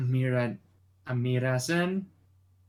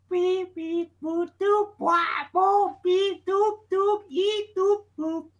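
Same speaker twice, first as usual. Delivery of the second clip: very high electronic beeping; phone beeps; 4 dB louder, slower than usual. Acted. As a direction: beep like a dying phone. Phone shutting down noises.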